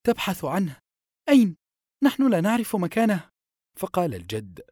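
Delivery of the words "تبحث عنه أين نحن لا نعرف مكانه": high-pitched, worried questioning; anxious, raised storybook voice; acted alarm. A narrator's reading. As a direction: protest in mild panic, pitched well up. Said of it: "أمسكت يده في هلع وهي تقول".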